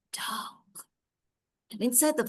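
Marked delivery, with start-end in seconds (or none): 0.1-0.9 hushed, whispered word for suspense; hushed storytelling. A narrator's reading. Storyteller describes the darkening forest, hushed whisper. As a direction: drop to a breathy whisper. Forest has become very dark.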